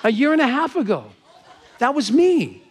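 loud, high-pitched exclamation; excited revelation. Retold or imitated. Woman telling seatmates she met them before, thrilled. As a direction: burst out with the amazing realization. Retold reveal of astonishing coincidence.

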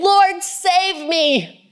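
slow, high plea of a drowning man; a sinking man's desperate high call; crying out for help. Acted. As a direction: drag out the cry Lord save me. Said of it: "he cried out, saying".

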